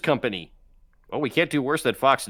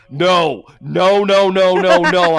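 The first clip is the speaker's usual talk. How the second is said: loud, higher rapid-fire refusal; emphatic refusal; 5 semitones higher, 10 dB louder, much slower than usual. Real, not acted. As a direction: shut the idea down loudly. Vetoes financial services category.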